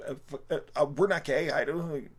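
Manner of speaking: high, loud stammering protest; startled high-pitched denial; flustered cashier. Retold or imitated. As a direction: sputter it out, caught off guard and embarrassed.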